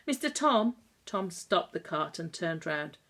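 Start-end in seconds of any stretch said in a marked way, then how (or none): 0.1-0.8 calling out. Acted. Weak boy calling to Tom.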